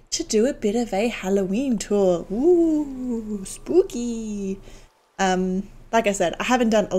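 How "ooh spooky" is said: drawn-out eerie ghost tone; spooky ghost voice. Acted. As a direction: ooh it out like a ghost. Mock spooky reaction on tour.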